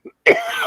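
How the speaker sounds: another dry cough fit; cough, then recover. Real, not acted. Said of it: "Oh, so sorry".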